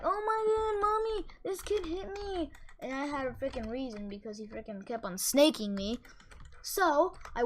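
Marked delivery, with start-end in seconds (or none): 0.0-2.5 mocking a whiny kid, pitched way up; high mock whine tattling to mommy; whiny crybaby kid. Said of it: "he'll go like".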